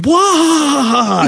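high yelping "wah"; mock war cry. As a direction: let out a silly high battle yell. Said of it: "I'm just going".

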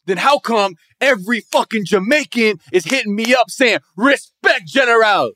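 high-pitched mock Jamaican patois. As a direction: imitate a put-on island patois, indignant. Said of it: "with that patois".

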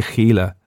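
Gila opening on a throaty Spanish ch; Spanish-style Gila. Shown as a demonstration. Showing how he says it, Spanish ch in Gila. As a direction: rasp the first sound of Gila, as in Spanish. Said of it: "that's Spanish with the ch".

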